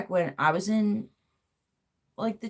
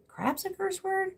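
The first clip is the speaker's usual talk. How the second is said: high-pitched, softer retold disbelief; incredulous question; 12 semitones higher, 6 dB quieter than usual. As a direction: question it in mock disbelief, voice jumping up. Retelling his disbelief at mom's rule.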